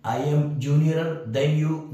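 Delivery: wrong example, read slowly. Shown as a demonstration. Teacher reading a wrong English example to students, slow and deliberate. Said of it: "नहीं होगा, गलत है".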